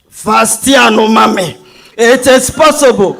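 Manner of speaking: half-laughing imitation of a trotro mate; trotro mate impression. [name] quotes a trotro mate to woo Ohima, light mock call. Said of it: "I will quote the famous Trotro mate".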